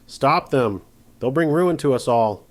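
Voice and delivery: loud desperate shout. A narrator's reading. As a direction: yell it over the chaos. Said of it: "The sheriff shouted behind them".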